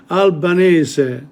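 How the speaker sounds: slow "Albanese", every syllable sounded through to "-zeh"; Italian pronunciation. Shown as a demonstration. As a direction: spell out "Albanese" by ear, slowly, as al-ba-NEH-zeh. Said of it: "pronunciation is syllabic in Italian".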